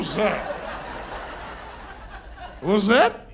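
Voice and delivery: put-on overly formal posh-sounding drunk; slow, careful fake grown-up voice; sober voice. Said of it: "I had the best sober voice".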